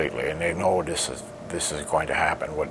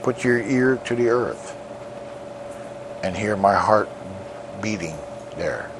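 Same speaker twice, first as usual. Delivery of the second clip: solemn poem recital; 3 semitones higher than usual. Acted. Lakota elder recites his poem to the world, solemn declamation. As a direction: proclaim each line like a chant to the people.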